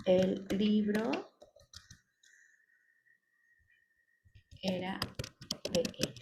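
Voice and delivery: drawn-out words with long gaps, softening; slow dictation. Shown as a demonstration. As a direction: dictate slowly while writing. Writing the example while saying it.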